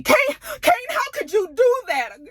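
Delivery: hammed-up high-pitched disbelief; shocked Auntie. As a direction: squeal his name like a scandalized aunt. Auntie's dismay, voiced.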